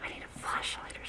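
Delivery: hushed excited whisper; whisper, trying not to scare it. Real, not acted. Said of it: "I'm afraid I'll scare it away".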